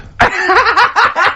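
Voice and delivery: very high peal of laughter; real shrieking laughing fit. Real, not acted. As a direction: break into helpless high laughter. Absurd twin story lands.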